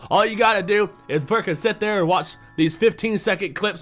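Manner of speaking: loud, fast heated rant; angry rant. Real, not acted. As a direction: tear into them, loud. Ranting about TikTok brains.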